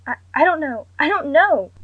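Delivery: high, loud, frantic repeating; panicked. A narrator's reading. Mother overwhelmed in the bathroom, panicked loud cry. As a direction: blurt it out in panic.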